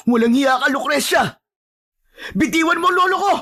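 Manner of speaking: high, outraged yell at an enemy; angry shout. Acted. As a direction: shout a furious demand for a release. Demands Lucrecia release his grandfather. (galit na sigaw)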